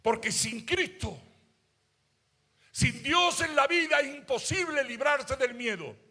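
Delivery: loud, high preaching voice, stretched out; fiery preaching. Acted. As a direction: preach it full out to the congregation. Preacher at full force.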